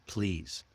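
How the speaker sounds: "please" low and soft, falling away; natural delivery. Shown as a demonstration. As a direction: toss off "please" quietly with a falling tune. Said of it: "say it faster and more naturally".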